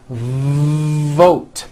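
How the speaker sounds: VOTE with a long buzzing V before the vowel; stretched V. Shown as a demonstration. Teacher showing long V, buzzing stretched VOTE. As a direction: hold the V buzz, then release into VOTE. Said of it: "like that".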